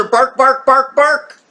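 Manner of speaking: mock dog barks. Imitating dogs playing.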